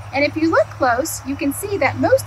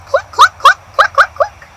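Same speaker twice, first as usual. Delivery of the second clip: very high, loud clucks; chicken clucking; 11 dB louder than usual. Acted. Chicken clucking at Duck, high and loud. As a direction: cluck away like a startled hen.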